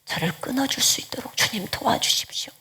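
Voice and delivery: 훨씬 작게 숨소리로 속삭이는 간구; 거의 들리지 않게 애원해. Shown as a demonstration. Whispered plea in sample prayer. (도둑질에서 끊어 달라며 속삭이듯)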